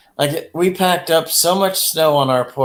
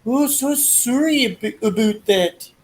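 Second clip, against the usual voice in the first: very high, slow Canadian cartoon voice; Canadian accent; 9 semitones higher, slower than usual. Retelling a Baby Yoda video, high slow Canadian accent. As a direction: squeak out a Canadian apology. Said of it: "he makes that little character say".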